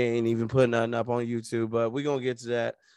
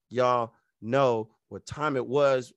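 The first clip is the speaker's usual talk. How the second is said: ordinary talk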